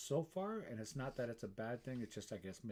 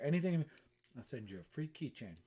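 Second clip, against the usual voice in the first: ordinary talk, nothing marked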